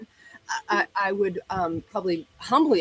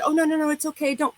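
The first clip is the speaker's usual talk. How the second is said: put-on higher, anxious girlfriend urging her to drop it; friend pleading, pitched up; 8 semitones higher than usual. Girlfriend in passenger seat calming her down, higher anxious pleading. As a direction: play the worried friend begging her to let it go. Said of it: "And she said".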